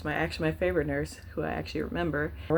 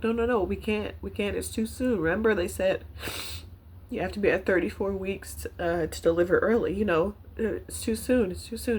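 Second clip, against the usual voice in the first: higher, upset protest, near tears; tearful pleading; 4 semitones higher than usual. Real, not acted. Patient begging nurse not to deliver yet, tearful pleading. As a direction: plead as if fighting back tears.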